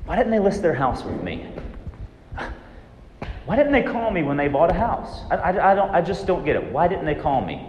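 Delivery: a lightly voiced agent stewing over a lost client; anxious agent's why questions. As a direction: ask yourself why like a worried agent who got passed over. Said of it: "When you ask why".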